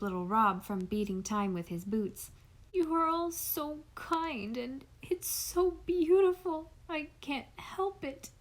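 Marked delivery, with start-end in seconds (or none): 2.7-8.3 put-on higher and slower, a boy crying through his words; overwhelmed, tearful and breaking; sobbing. A narrator's reading. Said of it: "sobbed Nat".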